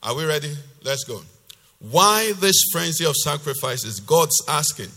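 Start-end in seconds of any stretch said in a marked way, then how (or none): 1.8-4.9 loud, emphatic preacher's reading; forceful scripture reading. Acted. Preacher reading God's rebuke to the congregation, loud and emphatic. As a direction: declaim God's rebuke with hard-hit key words. Reads the same passage in Message.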